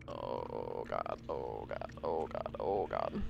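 very low dragging groan; deep groaning voice. Real, not acted. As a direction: drop into a deep moan. Pitch-dropped fear.

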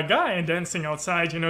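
usual speaking voice

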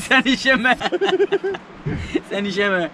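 laughing through the words; real laugh despite the pain. Real, not acted. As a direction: giggle while refusing. Laughs off friend's joke.

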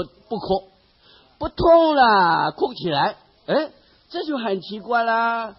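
ordinary talk

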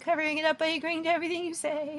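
high-pitched mock awkward agreeableness; uncomfortable. Mocking Phoebe covering up by agreeing, high uncomfortable voice. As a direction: voice the nervous inner thought up high. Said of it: "I'm uncomfortable".

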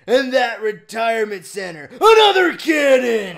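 put-on booming military villain barking orders at his boys. Reading Ammo Baron's lines to co-player, a hammed-up villain shout. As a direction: command the troops like a mad general.

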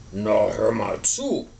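low, slow grumpy walrus voice; big grumpy animal; grumbling walrus. A narrator's reading. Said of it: "grumpelte Frau Walross".

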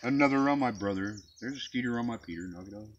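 ordinary talk, nothing marked

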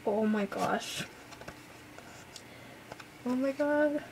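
usual speaking voice

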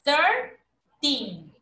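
Thirteen ending clearly in a drawn-out "tin"; teen ending. Shown as a demonstration. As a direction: model Thirteen slowly, stressing the tin at the end.

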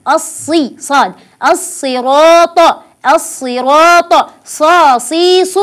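الصراط said slowly, with an emphatic saad and a heavy raa; الصراط, thick saad and a full taa; slow correct reading. Shown as a demonstration. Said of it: "والصواب أن أقول".

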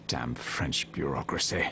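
low grumble under the breath; sulky low grumbling; in a strop. Acted. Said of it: "Malachi in the strop".

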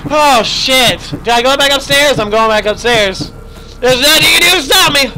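fleeing in a panic, up the stairs; frantic escape. Real, not acted.

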